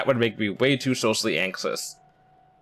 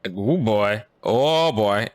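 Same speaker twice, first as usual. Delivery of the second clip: amused groan; 4 dB louder, much slower than usual. Real, not acted. Reacting to in-game sexist line.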